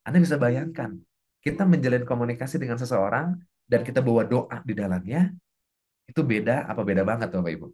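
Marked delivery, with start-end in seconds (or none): none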